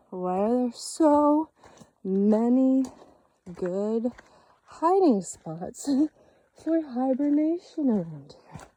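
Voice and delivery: slow, loud and drawn out; loud uneasy exclamation. Real, not acted. Hiker spotting a possible bear den, loud uneasy exclamation. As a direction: stretch it out loudly, breaking into a laugh.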